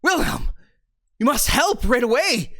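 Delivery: tripping over words in a breathless fluster; flustered villager bursting in with bad news; flustered panic. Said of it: "flustered and red in the face".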